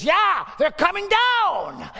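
high, loud, very slow drawn-out cry; preaching cry. Real, not acted. Preacher condemning prosperity teachers with a slow, high, loud cry. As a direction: stretch every word in a ringing peak.

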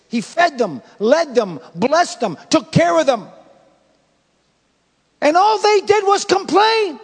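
builds up high and loud on "they"; rising preaching climax. Acted. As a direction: hit "they did was complain" with full preaching force.

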